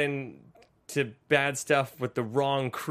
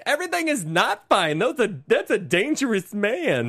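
higher, lightly hammed-up mom line; mimicking the mother; 6 semitones higher than usual. Host quoting Lisa's mom's hysterical warning, mildly mocked. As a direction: quote the mother's overdramatic warning. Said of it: "and the mother's hilarious".

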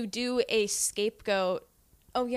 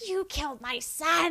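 mock accusation; 8 semitones higher, slower than usual. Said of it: "everyone was like".

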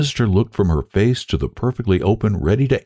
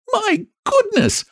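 very high, drawn-out astonishment; high-pitched shock; shocked exclamation; slower than usual. A narrator's reading. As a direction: exclaim it high and slow, scandalized. Lottie shocked by the child's admission.

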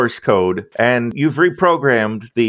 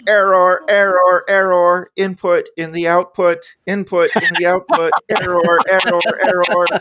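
glitching robot; 9 semitones higher than usual. Acted. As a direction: squeal the error alarm like a broken machine. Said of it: "suddenly you hear him squeal".

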